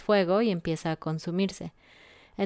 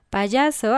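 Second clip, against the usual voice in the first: "Payaso" called out louder and higher; announced word; 4 semitones higher, 6 dB louder than usual. Shown as a demonstration. Teacher introduces the next word, emphatic. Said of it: "Vamos a empezar con la palabra payaso".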